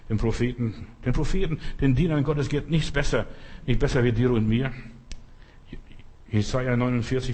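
ordinary talk, nothing marked

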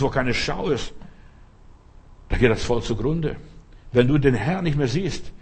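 usual speaking voice

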